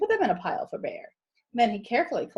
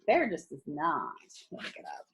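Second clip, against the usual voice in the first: whisper; 8 semitones lower, 6 dB quieter than usual. Real, not acted. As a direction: lean in and whisper it. Aside to listeners while reading.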